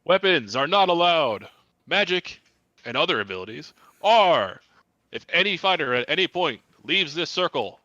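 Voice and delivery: loud, high fight announcer; arena announcer. Acted. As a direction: call out the rules to the crowd.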